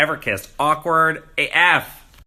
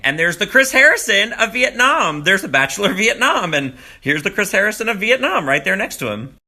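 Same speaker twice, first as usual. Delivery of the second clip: excited; 8 semitones higher, 5 dB louder than usual. Real, not acted. Excited about Vietnam Chris Harrison.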